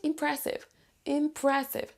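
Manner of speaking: IMPRESSIVE with PRESS pitched high; im-PRESS-ive, stressed middle; stress on second syllable. Shown as a demonstration. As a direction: lean on PRESS in IMPRESSIVE, then fall. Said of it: "on va prononcer plus fort la syllabe".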